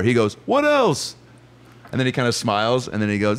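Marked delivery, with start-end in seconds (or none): 0.5-1.2 high-pitched, lilting star impression; high mimicked celebrity voice. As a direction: greet in a high put-on voice. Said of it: "Paul McCartney's here".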